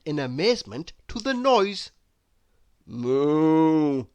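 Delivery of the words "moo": long drawn-out moo; cow mooing. Acted. Cows in the farmyard noise, mooing. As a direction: imitate a cow's steady moo. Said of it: "mooed the cows".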